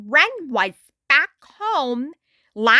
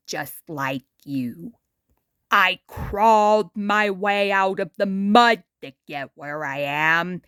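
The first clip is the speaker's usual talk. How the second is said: put-on lower, laid-back old star voice; a worn-out celebrity talking down to a kid; Muddy Mud Skipper; 6 semitones lower than usual.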